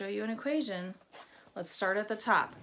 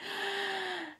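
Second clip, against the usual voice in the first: high, sharp inhaled gasp; big gasp; 9 semitones higher, 4 dB quieter than usual. Shown as a demonstration. Teacher taking a deep breath before counting down. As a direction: suck in air before a long count. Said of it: "take a deep breath".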